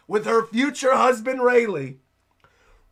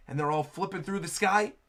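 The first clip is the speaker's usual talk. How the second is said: normal talking voice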